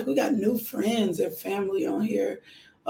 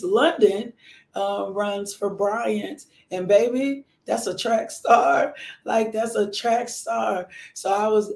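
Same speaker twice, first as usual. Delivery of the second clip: ordinary delivery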